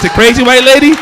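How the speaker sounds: ordinary talk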